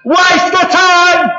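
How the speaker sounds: high, stretched-out holler; calling back. Acted. Fishermen complain to the man on shore, calling loudly. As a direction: shout it slowly across the water.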